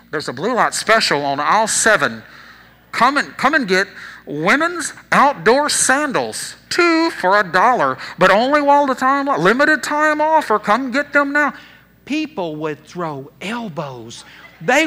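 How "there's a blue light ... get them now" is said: loud, drawn-out store intercom announcement; booming mock store announcer, slowed and punched. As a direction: call out a special over the store intercom. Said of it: "over the intercom".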